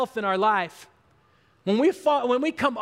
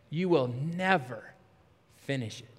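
discouraging inner voice; 9 semitones lower, 5 dB quieter than usual. Acted. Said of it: "the voice we hear more than any other voice".

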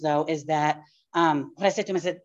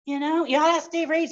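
ordinary delivery

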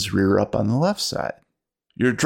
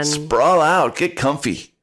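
stoner bus driver voice; 5 semitones higher, slower than usual. Acted.

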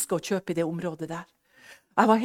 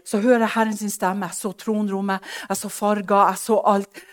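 ordinary talk

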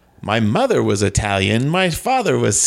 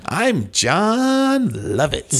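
slow, hammed-up name announcement; grandiose self-announcement; 5 semitones higher, much slower than usual. Comic guest proclaims himself to host, slow grandiose delivery.

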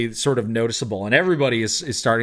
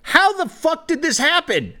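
very high, incredulous outcry; hammed-up astonishment; shocked captain. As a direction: demand an explanation, pitch shooting up. Captain shocked at dog in room.

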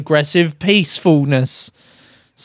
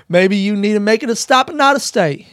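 higher, put-on American star accent; Matthew McConaughey; 6 semitones higher than usual. Telling newcomers to move on, imitated McConaughey accent. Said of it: "I can't do the fucking accent".

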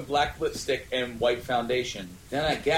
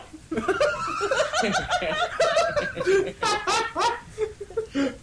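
high helpless laughter. Real, not acted. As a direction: crack up completely. Reader laughing hard.

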